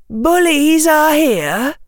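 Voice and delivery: put-on high, slow, terse girl's report; laconic. A narrator's reading. Susan warns the group of bullies, laconic. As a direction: announce it in few words, slowly and high. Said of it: "Susan said laconically".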